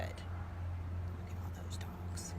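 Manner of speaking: hushed low whisper; whispered aside. Acted. Librarian whispering as Jules in the story. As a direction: breathe it like a secret.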